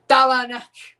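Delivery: shouted Quebec French oath; Quebecois swear. Shown as a demonstration. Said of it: "more Quebecois, Canadian French".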